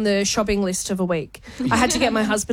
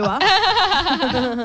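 slow, high, broad bogan "Entrepreneur."; Bungendore accent; 5 semitones higher, much slower than usual. Retold or imitated. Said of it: "Hello, Bungendore".

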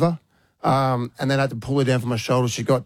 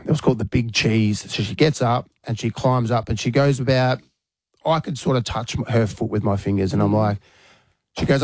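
normal talking voice

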